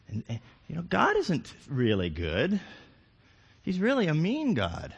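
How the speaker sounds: high, insinuating tempter voice, lightly voiced; sly whispering tempter in the ear; Satan tempting. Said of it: "That's what Satan does".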